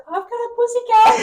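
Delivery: high, loud imitation of a friend's delight; excited squeal. Acted excited quote of her friend.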